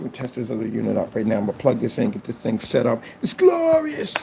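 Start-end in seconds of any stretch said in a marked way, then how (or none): 3.2-4.1 very high, slow sing-song glee; mock grand delight. Celebrating the finished website in a high sing-song voice. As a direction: crow it like a delighted diva.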